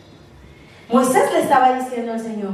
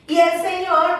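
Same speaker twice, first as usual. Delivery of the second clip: ordinary talk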